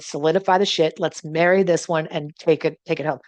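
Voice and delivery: ordinary talk, nothing marked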